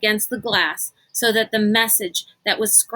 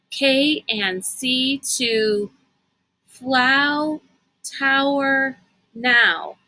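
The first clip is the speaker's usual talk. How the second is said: slow, higher, word by word; slow decoding; 4 semitones higher, much slower than usual. Acted. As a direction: spell it out as you decode. Kate slowly reading message.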